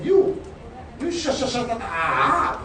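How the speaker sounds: high, loud angry yell; angry shout. Retold or imitated. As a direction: bark it at the kid. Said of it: "He said".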